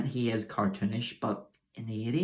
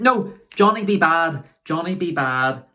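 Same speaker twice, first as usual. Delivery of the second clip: loud, high hype call of the name; excited shout; 6 semitones higher, 8 dB louder than usual. Real, not acted. Reviewer hyping a wrestler's name to viewers, loud and excited. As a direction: announce Johnny B. Bad like a ring caller.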